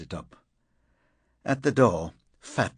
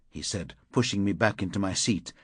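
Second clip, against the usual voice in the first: usual speaking voice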